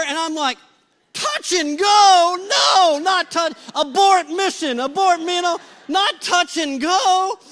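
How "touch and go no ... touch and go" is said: high, loud, drawn-out mock alarm; alarmed shout. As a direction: shout to call the whole thing off. Retold alarmed reaction to botched prank.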